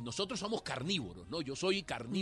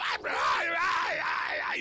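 louder, wild pitch swings, letting off steam; venting yells; 14 semitones higher, 8 dB louder, slower than usual. Retold or imitated. Husband blowing off rage in the car, loud yelps. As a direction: cry out the anger alone in the car. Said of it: "Yo, yo tengo rabia ahora".

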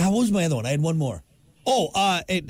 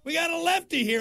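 mocking heckler; 11 semitones higher, 3 dB quieter than usual. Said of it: "look at that".

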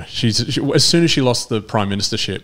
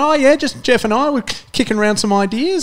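much higher, breezy mock-innocent voice; Billy Brownless impression; 13 semitones higher than usual. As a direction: play it cagey and innocent, pitched way up. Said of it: "And he's going".

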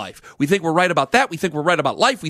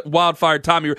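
ordinary talk

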